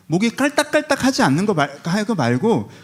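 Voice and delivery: preacher hammering the point; emphatic preaching. Real, not acted. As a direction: drive the point home. Emphatic sermon peak.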